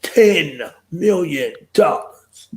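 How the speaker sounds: high, slow call with TEN stretched out; announcer sound bite. Acted. Host trying out a fundraising sound bite, big announcer voice. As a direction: belt Ten million dollars like a jingle. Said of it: "a little sound bite that does it".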